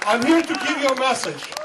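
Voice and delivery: normal talking voice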